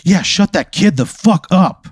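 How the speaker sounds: high, drawn-out crowd heckle; heckler's yell. Acted. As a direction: holler it slowly from the back of the crowd. Rowdy crowd member yells at mom.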